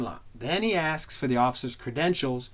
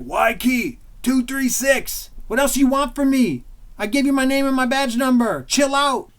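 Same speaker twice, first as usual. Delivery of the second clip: raised, fed-up voice; exasperated cop; 8 semitones higher, 6 dB louder than usual. Retold or imitated. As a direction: tell him to chill out, louder. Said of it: "He's like".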